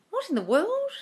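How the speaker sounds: high, startled cry of disbelief; shocked disbelief. Real, not acted. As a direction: react as if you can't believe it. Reacting to shocking viewer question.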